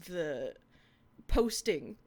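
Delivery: ordinary delivery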